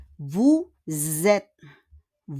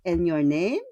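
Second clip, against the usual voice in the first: ordinary talk, nothing marked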